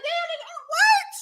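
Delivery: high, laughing, half-crying disbelief; high-pitched shocked laughing protest; stunned disbelief. Retold or imitated. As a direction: squeal it out in shocked amusement. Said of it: "I said".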